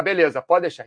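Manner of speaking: ordinary talk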